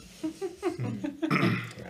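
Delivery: high burst of laughter. Real, not acted. GM laughs at the question.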